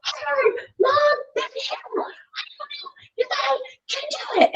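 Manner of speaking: put-on high, strained, near-crying child voice; whiny kid. Five-year-old son to mom on the hill, high whiny crying voice. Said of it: "he's like".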